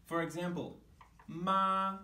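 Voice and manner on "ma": "ma" held high and level, louder; flat tone. Shown as a demonstration. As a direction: say "ma" on one unchanging high note. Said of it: "pitch of my voice was flat".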